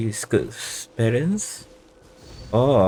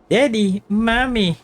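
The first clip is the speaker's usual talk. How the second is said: mock child calling; 13 semitones higher than usual. Voicing lost child calling parents.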